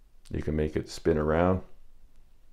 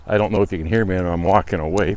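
ordinary talk

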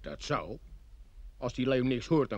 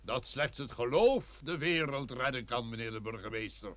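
solemn pronouncement; slower than usual. Acted. Priest pronounces on faith.

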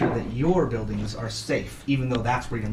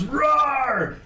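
high, hammed-up monster roar; 11 semitones higher, 3 dB louder than usual. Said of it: "And the monster goes".

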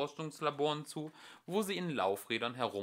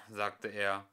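plain reading voice

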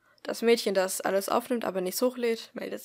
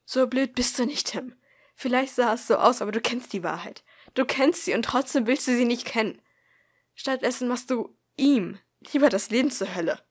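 angry outburst; 3 semitones higher, 8 dB louder than usual. A narrator's reading. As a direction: let the anger build and burst out. Acted outburst after snorting at Tim.

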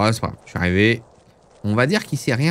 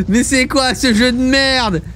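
slow, high, drawn-out rage; raging gamer; 8 semitones higher, slower than usual. Real, not acted. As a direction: drag out each word in disgust. Raging after losing the race. (rage lente et aiguë)

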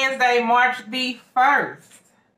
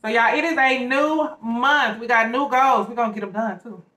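talking while chewing food; speaking with sandwich at mouth; mouth full of sandwich. Real, not acted. Seen 0: Sandwich held against mouth, apparently biting, while she talks.

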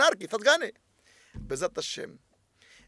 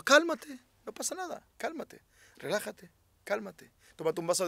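soothing; 8 dB quieter than usual. Acted. Rabbi soothes an angry listener.

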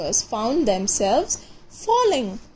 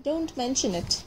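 much softer repeat, fading away; softer echo; 8 dB quieter than usual. Acted. Squirrel dangling from the string, softer repeat. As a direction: let it fade off to nearly nothing.

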